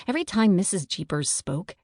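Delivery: plain reading voice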